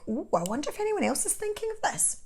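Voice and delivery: high, lilting voiced thought; musing aloud. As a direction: lift the pitch well up as if wondering. Voices her own wondering thought.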